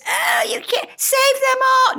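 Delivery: very high, whiny mimicry; mocking wail. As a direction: squeal it like a parody of a softhearted rescuer. Mocks bleeding-heart dog rescuers.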